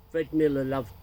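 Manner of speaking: usual speaking voice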